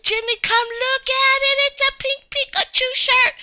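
high, excited calling over; thrilled little-kid pitch; delighted discovery. Pretend. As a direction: call a friend to see something amazing. Spots exciting Pikachu shirt.

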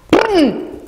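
high blurted raspberry noise; imitated fart. A narrator's reading. Ox full of cabbages lets one go, imitated fart noise. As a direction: blurt a comic fart sound.

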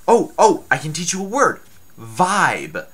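excited. Acted. Excited burst teaching a word.

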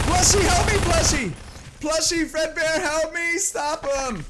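high, loud hammed-up plea; playful panicked wail for rescue; calling for help. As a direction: cry out desperately to a toy protector. Calls plushie for help while chased.

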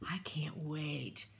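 a breathy whispered aside, slow. Real, not acted. To viewers about cocoa, a slow excited whisper. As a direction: lean in and whisper your excitement.

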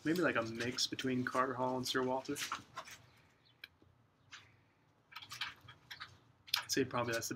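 talking around pipe stem; pipe in mouth. Real, not acted. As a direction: lips clamped on pipe. Seen 1: Pipe stem held between lips throughout, hand supporting the bowl.

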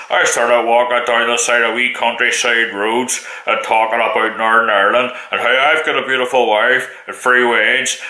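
lightly voiced, earnest Northern Irish vlogger pitch; imitating a Northern Irish YouTuber. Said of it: "he's going like this here".

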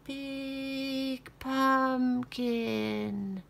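slow, drawn-out mummy. Acted. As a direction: groan it like a mummy. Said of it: "said the mummy".

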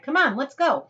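high, loud eager call; gleeful cry. Acted. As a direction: whoop it out with joy. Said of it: "he cried".

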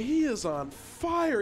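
high, loud burst of hype; thrilled caster's shout; excited play-by-play. Real, not acted. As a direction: call the big play with rising excitement. Live cast of triple kill.

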